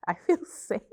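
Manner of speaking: relieved laugh into feel safe. Real, not acted.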